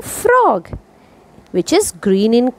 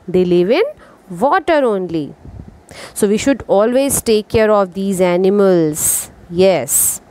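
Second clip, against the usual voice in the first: usual speaking voice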